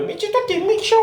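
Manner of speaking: put-on very high, cute sulky baby voice; squeaky little-girl whine, half laughing; teddy bear voice. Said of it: "sa petite voix de nounours".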